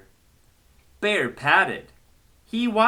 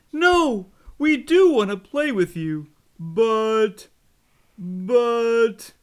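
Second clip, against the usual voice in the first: story voices; 7 semitones higher than usual. Acted. Snake and friends speak.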